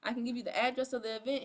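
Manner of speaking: perky imitation of a sales message; mock eager pitch. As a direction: imitate an over-keen recruiter gushing. Acts out recruit's eager pitch.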